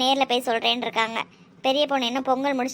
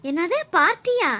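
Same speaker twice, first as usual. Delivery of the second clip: high, drawn-out slow question; astonished; 6 semitones higher, 4 dB louder, slower than usual. Acted. Friend hearing about the party, astonished question. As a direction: stretch it out in disbelief. Surprised reaction to party news.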